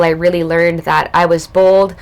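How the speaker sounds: ordinary talk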